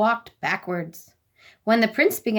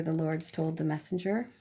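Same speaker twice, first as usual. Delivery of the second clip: plain reading voice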